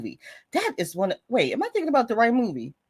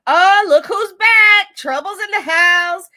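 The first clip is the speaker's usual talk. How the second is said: excited greeting; 8 semitones higher, 8 dB louder, slower than usual. Real, not acted. Greeting a returning viewer.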